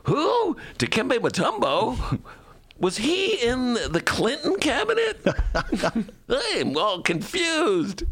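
high, slow baffled voice; mock listener lost on sports; confused Bernie bro. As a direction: play a clueless fan puzzling aloud. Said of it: "All my Bernie bro listeners, like".

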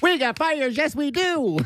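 very high squealed cheer; cheerleader. Acted. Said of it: "They're still cheering".

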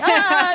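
带笑的假尖叫; mock scream. Shrieking at hair like spider.

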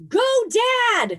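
high-pitched imitation of a screaming kid; mock scream. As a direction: cheer it in a very high mock yell. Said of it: "I kept screaming".

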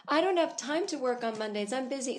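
sing-song mock mom voice; mocking the live laugh love lady. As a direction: play the smug commenter lady. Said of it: "live, laugh, love, lady".

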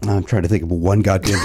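ordinary delivery

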